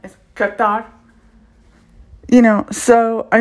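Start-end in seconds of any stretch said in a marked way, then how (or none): 0.3-0.8 "Qatar" with hard k start, kuh-TAH; firm "Qatar", hard first consonant, open last syllable; corrected pronunciation. Shown as a demonstration. Learner correcting the train word's first sound, hard k. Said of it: "But it's not S sound".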